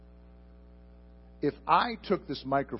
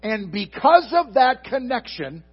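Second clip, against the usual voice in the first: raised, higher, louder preaching voice, building; fervent preaching; 7 semitones higher, 6 dB louder than usual. Acted. Pastor stirs the congregation about abiding, fervent and loud. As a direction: preach it out full force to the back row.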